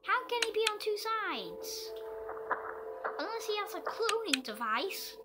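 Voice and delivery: high, loud frightened question; alarmed, voice jumping up; panicked disbelief. Real, not acted. Panicked at monster on both sides.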